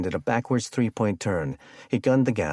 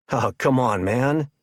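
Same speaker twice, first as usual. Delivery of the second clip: loud, high, amused protest; yelling through laughter; 6 semitones higher, 6 dB louder, slower than usual. A narrator's reading. Captor teases his terrified captive, yelling through laughter. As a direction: yell it as if cracking up. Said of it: "Wade yelled through his belly laughter".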